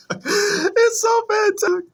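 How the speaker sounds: high, drawn-out words breaking up with laughs; real laughter. Real, not acted. Vlogger tickled by his own snowman impression, laughing. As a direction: squeal it through laughter, very high.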